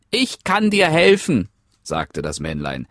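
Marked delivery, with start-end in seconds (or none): 0.1-1.5 little man. Acted. Männlein bietet Hilfe an.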